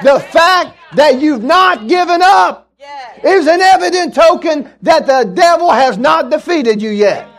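high, loud, triumphant shout; full-force preaching shout. Real, not acted. Preacher repeating his point to the congregation, shouted in triumph. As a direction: shout it out to rouse the church.

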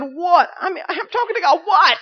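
high loud startled what; startled shout. Retold or imitated. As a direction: jolt out the word in alarm. Startled reply to God's shout.

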